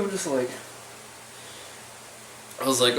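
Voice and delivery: usual speaking voice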